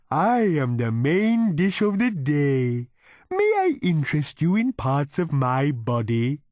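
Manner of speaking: high, slow, polite cow voice; a cow waiter offering its own meat; cow character. A narrator's reading. Cow offering diners its own body, in a high, slow polite voice.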